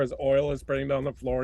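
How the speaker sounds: ordinary delivery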